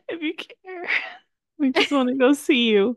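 high, giggly pretend begging; laughing mock plea. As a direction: plead jokingly through laughter.

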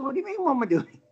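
high, well-spoken puzzlement. Retold or imitated. Older angler challenges the question, lightly well-spoken. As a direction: lightly imitate a well-spoken man taken aback. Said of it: "and very well spoken".

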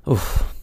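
breathy relieved exhale after drinking; satisfied sigh after a sip. Real, not acted. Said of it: "take a quick little momentary break".

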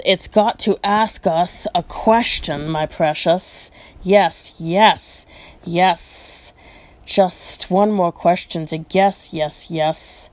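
ordinary delivery

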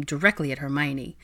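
ordinary delivery

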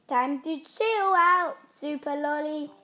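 plain reading voice

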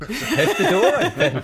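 said through high laughter; laughing. Real, not acted. GM cracking up at the table, laughing. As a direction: laugh the words out.

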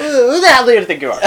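loud, high, fast mock indignation; teased friend snaps back in mock anger; laughing mock outrage. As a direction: blurt it out offended and laughing.

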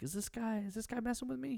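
high-pitched, baffled questioning; mock incredulous. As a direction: imitate someone squeaking in disbelief. Retold diner asking the owner.

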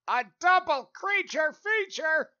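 high, loud, slow announcer boom; big creature feature announcer; announcer hype. Pretend. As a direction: sell it like a horror trailer. Hyping up the movie topic.

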